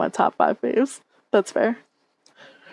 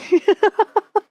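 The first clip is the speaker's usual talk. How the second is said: sudden high, loud laugh; 11 semitones higher, 5 dB louder than usual. Real, not acted. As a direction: burst out laughing. Laughing at own joke.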